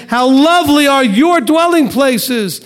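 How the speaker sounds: lifted, slow quote of the verse; joyful scripture quote. Acted. Teacher voicing the psalmist's joyful cry, lifted and slow. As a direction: let the line ring out as a joyful cry.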